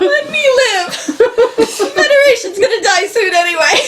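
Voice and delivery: high, laughing protest. Real, not acted. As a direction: protest through giggles.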